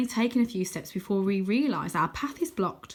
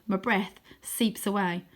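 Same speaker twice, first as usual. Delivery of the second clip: plain reading voice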